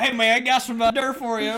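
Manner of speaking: loud, high Southern twang; Southern accent. Retold or imitated. Imitating friends by the end of Minecraft, loud Southern accent. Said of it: "then by the end".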